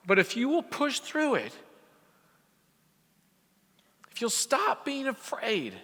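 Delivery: high, impassioned, building to a peak; rally the room like a revival preacher; preaching at full force. Acted.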